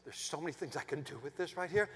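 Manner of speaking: low, soft, awed visioning; gushing designer. Retold or imitated. Designer visioning the backyard with the wife, hushed gushing. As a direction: murmur it like a dreamy designer. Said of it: "talking like this and designing".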